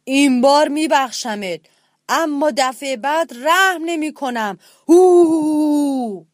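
very loud roaring threat ending in a roar; loud lion roar. A narrator's reading. Lion roaring after them as they leave. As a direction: warn them off with a final roar. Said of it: "صدای نعرهٔ بلند شیر".

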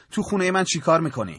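تند و کلافه دوباره بپرس; پرسش تند و کلافه. Acted.

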